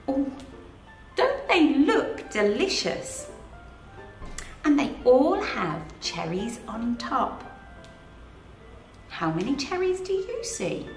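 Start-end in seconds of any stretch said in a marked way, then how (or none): none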